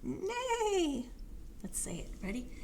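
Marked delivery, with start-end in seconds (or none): 0.0-1.1 high, falling whinny; horse neigh. Pretend. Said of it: "Horse bellowed".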